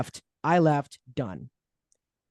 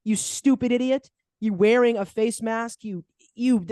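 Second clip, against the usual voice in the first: higher, scornful jabs at the doctor; mock scolding; 6 semitones higher than usual. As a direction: talk down to him like an idiot. Mocking the masked doctor.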